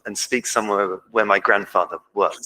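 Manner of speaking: ordinary talk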